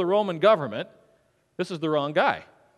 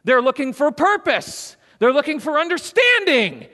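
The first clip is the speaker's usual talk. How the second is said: building sermon climax; 6 semitones higher, 6 dB louder than usual. Acted. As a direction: build each "They're looking for" line bigger than the last.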